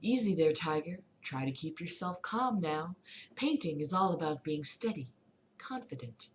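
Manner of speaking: gentle, soft painter's voice; soft Bob Ross voice. Acted. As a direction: soothe him like a calm teacher.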